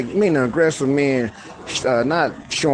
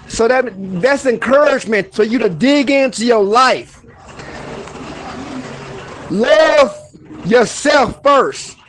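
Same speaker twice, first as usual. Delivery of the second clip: much higher and louder, fired up; fired-up motivational rant at viewers; worked-up rant; 9 semitones higher, 3 dB louder than usual. Acted. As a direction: preach at them with frustration. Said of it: "And I'ma calm down".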